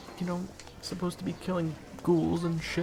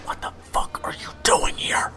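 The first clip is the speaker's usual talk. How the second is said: hissed, urgent whisper; angry whisper; 4 dB louder than usual. Acted. Waiter Zach whispering at his friend, angry. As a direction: whisper it sharply so nobody hears.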